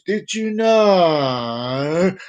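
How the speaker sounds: very slow, stretched sing-song; a slow teasing singsong; drawn-out catchphrase. Pretend. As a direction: draw out each word.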